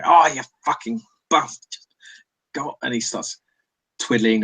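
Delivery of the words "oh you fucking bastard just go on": mock outburst of frustrated swearing; exasperated cursing. Old mechanic to the sparking fuse box, exasperated cursing. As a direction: curse at a stubborn machine, lightly hammed-up.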